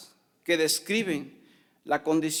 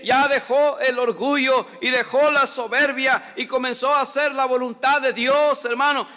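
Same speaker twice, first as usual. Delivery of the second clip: loud, higher impassioned sermon; fiery preaching; 7 semitones higher, 6 dB louder than usual. Acted. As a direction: preach it out to the whole congregation. Preaching at full force. (predicación fervorosa, fuerte y alta)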